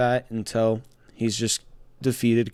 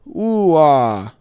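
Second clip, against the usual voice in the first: mock crowd groan; 3 semitones higher, 7 dB louder than usual. Mimics crowd reaction turning sour.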